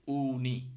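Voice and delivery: "উনি" with a long pure উ; Finnish U as oo. Shown as a demonstration. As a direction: stretch the oo of "উনি". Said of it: "ইউটা কিন্তু উর মতো উচ্চারণ হচ্ছে".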